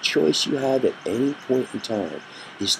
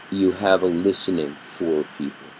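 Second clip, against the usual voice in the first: ordinary talk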